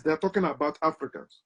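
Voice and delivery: usual speaking voice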